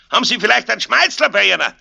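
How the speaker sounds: fast, high, loud Bavarian call; shouted request. A narrator's reading. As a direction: holler the question after someone passing. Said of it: "schrie Aloysius". (schreiend nachgerufene Bitte)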